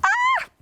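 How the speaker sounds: high sudden mock shriek; pig scream. Pigs fleeing the wolf, high mock shriek. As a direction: squeal like a frightened piglet. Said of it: "the pigs do this".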